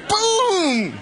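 very high, loud, laughing boom; bigger laughing boom. Shown as a demonstration. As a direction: land it harder with a laugh. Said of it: "How much more power can you put on something".

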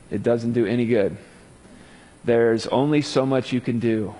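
normal talking voice

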